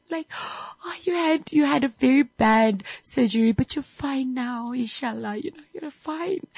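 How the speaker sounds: high, gushing relieved wife. Retold or imitated. Wife welcoming her husband back from the coma, high and gushing. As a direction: gush over him like a tearful wife.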